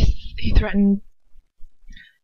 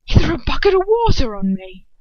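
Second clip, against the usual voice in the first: raised, loud angry protest; furious; 6 semitones higher, 8 dB louder than usual. Acted. Draco complaining to his father, furious. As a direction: spit it out in fury. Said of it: "he says furiously".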